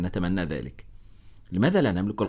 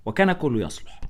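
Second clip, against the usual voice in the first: ordinary delivery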